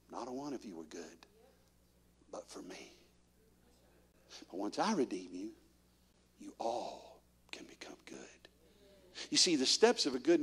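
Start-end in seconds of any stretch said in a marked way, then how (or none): 0.1-8.5 much softer, nearly whispered; hushed voice of the Lord. Retold or imitated. Preacher voicing the Lord telling the church, hushed. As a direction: let it fall to a whisper.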